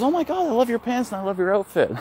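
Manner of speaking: high, gushing compliment voice; gushing older woman. Retold or imitated. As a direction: play an excited lady in her sixties complimenting. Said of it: "She goes".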